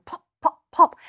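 short, punchy popping noises; imitated popping. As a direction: pop like burning gum trees. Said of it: "they were going".

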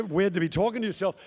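normal talking voice

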